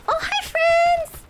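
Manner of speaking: high, slow, sing-song mock hello; mock greeting. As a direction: greet the monsters in a teasing falsetto.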